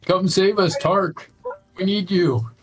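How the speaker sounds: high-pitched in-character call for help; acted plea. Player calls Tark in to help.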